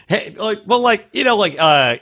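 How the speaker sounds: ordinary talk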